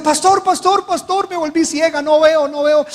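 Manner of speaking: high-pitched frantic plea; blinded woman calling her pastor, high panicked plea; panicked cry. Retold or imitated. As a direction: voice the blinded woman crying in alarm. Blinded pregnant woman cries out.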